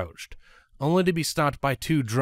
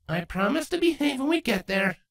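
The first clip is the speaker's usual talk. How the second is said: higher, bright pony voice promising; Twilight's voice. Acted. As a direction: promise sweetly, a little higher. Character Twilight line.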